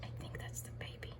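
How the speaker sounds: faint breathy whisper; wildlife watching whisper. Real, not acted. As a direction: whisper so you don't scare them. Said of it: "I'm whispering so I don't scare them".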